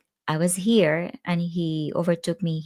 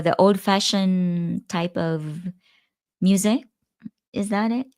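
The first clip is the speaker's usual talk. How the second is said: mock clueless. Teasing a friend about vinyl, mock clueless questions. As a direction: pretend never to have heard of records.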